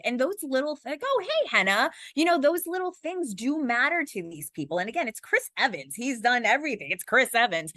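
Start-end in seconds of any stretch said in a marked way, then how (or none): 1.0-1.9 high-pitched friendly hello, re-enacted; pleased recognition. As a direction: imitate someone greeting an acquaintance they just recognized. Acting out a celebrity's greeting back.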